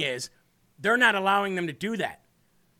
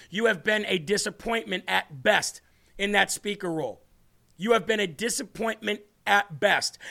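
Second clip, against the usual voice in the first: ordinary delivery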